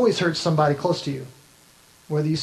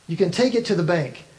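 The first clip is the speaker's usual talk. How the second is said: normal talking voice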